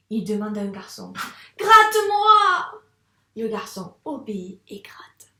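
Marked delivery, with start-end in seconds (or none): 1.6-2.8 very high, loud, drawn-out cry; exclaimed demand. Shown as a demonstration. Teacher modelling the reading for the pupil, loud high cry. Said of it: "je te montre comment on doit lire". 3.4-5.3 lowered voice at the full stop. Shown as a demonstration. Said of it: "il y a un point final".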